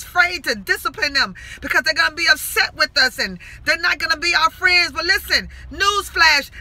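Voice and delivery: loud, forceful plea; impassioned preaching. Real, not acted. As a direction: exhort them like a fired-up preacher. Impassioned plea to youths and parents.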